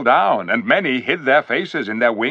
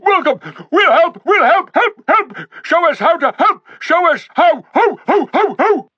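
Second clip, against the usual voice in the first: much higher, louder eager yelps; joyous barking welcome; 7 dB louder than usual. A narrator's reading. Talking dogs greeting the king, joyous eager barking. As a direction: bound in barking with joy. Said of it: "all saying at once".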